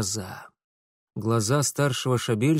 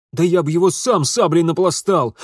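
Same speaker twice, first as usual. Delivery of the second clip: loud, higher hot-headed retort; heated bragging; 5 semitones higher, 8 dB louder than usual. Acted. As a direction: boast hotly over your father. Hot-headed son boasts of fighting.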